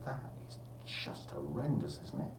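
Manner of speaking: whispered, saddened; hushed aside. Real, not acted. Presenter reacting to deaths in Greece, whispered. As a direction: drop to a whisper at the awful news. Sombre news aside, unvoiced.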